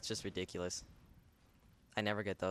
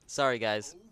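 usual speaking voice

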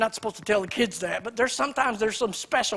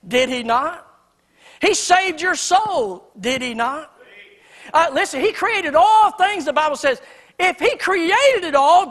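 louder, higher rhetorical build; rising preaching; 5 semitones higher, 6 dB louder, slower than usual. Acted. Preacher pressing the crowd with questions, building fervor. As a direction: drive each question home to the pews.

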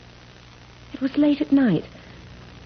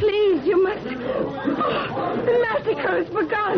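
frightened plea; 9 semitones higher, much slower than usual. Acted. Daughter pleads as massacre begins.

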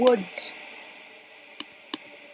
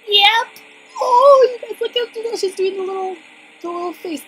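high, loud squeal at something cute; squealing over cute; 11 semitones higher, 10 dB louder than usual. Real, not acted. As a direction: gush over the cute little face. Gushing over a cute character.

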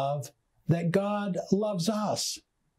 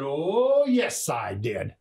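mock-indignant, disrespectful comeback, drawn out; condescending retort; 4 dB louder, slower than usual. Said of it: "in a condescending voice with a very disrespectful tone".

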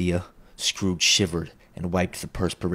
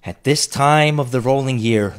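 lamenting spectre; 6 semitones higher, 6 dB louder than usual. A narrator's reading.

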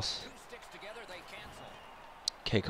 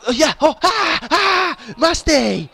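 very high, loud whooping; wild shouted joy; ecstatic goal celebration; 13 semitones higher, 10 dB louder, much slower than usual. Real, not acted. Celebrating a goal just scored.

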